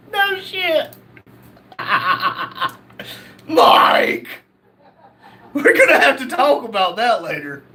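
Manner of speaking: high-pitched words through laughter; real helpless laughter. Real, not acted. Host answers Mike after crude news, laughing helplessly.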